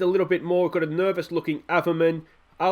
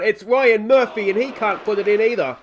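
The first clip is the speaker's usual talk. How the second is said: rising, louder, slowing on the near miss; excited commentary; 5 semitones higher, 5 dB louder than usual. Real, not acted. Commentating on his own team's missed chance, excited. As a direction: let the chance build then sag.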